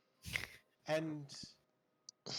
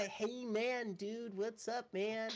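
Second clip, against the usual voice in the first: higher-pitched laid-back dude voice; mock mellow hippie greeting, pitched up; dropped stoner act; 6 semitones higher, slower than usual. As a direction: put on a druggy "hey man" persona. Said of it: "That's all been an illusion".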